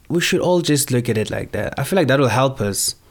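ordinary delivery

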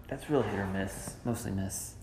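muffled behind a hand; hand over mouth. Real, not acted. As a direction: speak through your hand. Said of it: "Take your hand away from your mouth".

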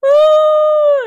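high loud crying wail; hammed-up sob; mock wail. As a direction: wail it out like a sobbing child. Crying wail in retold scene.

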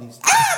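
very high, loud shriek; raccoon shriek. Acted. Raccoon hearing the splash, high scared shriek. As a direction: shriek in sudden fright.

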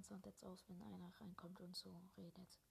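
breathy whisper to the viewers; real hushed aside. Real, not acted. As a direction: whisper it so nobody else hears. Whispering so teammate can't hear.

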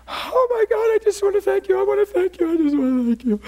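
overwhelmed woman sobbing thank you; high tearful gushing gratitude; weeping caller. Retold or imitated.

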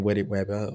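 "wetted wabbit" said on purpose with Ws replacing the Rs; deliberate W for R. Shown as a demonstration. Host giving in to the tongue-twister, deliberately saying "wetted wabbit" with Ws. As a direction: own the slip, saying "wetted wabbit" with babyish W sounds. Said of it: "I'm gonna just start saying wetted wabbit hole".

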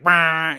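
abrupt mock blare; imitated jolt noise. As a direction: blurt a sudden movie-sting noise. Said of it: "the opener's like".

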